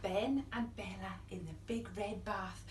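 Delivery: hushed unvoiced whisper reading the line. Storyteller whispering the refrain to little listeners. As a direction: breathe it like a secret.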